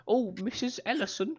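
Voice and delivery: high, prim greeting; mock polite schoolchild; formal student voice. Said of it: "To not call them like".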